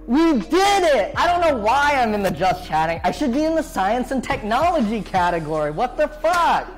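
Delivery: loud, high, excited cheering; triumphant cheer. Real, not acted. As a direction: celebrate the win out loud. Celebrates fixing audio sync.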